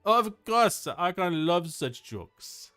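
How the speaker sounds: put-on high, loud, slow French-accented delivery; hammed-up French accent. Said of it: "Why am I doing a French accent?".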